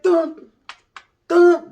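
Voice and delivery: high loud dun dun; sung beat. Acted. Imitating the oldie's beat as the vato arrives, high and loud. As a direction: belt the beat like the record.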